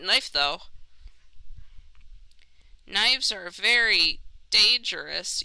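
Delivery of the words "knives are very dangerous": slow careful warning; said very slowly. Acted. Warning about knives, said very slowly. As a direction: drag it out slowly. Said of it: "You said that very slowly".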